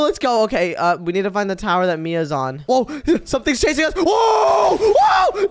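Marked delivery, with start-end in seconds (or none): 2.7-5.5 high shrieking whoas; panicked screaming. Real, not acted. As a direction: shriek while fleeing. Something chasing them.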